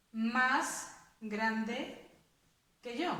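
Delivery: normal talking voice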